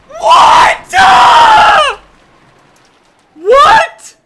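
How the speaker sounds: very high, very loud shriek; shrieking what. Real, not acted. Streamer to chat, shrieking at the delivery fee. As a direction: scream it in total disbelief. Outraged at ten dollar fee.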